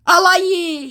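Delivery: mock scammer greeting. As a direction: call out as the scammer. Voicing scammer.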